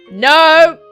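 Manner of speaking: big dramatic yell of no; shouted refusal. Acted. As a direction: shout it out in protest. Acted outburst of refusal.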